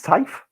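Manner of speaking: "Taif" said to rhyme with life; suggested pronunciation. Shown as a demonstration. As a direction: offer "Taif" with a long eye vowel. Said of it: "that's how I'd say it".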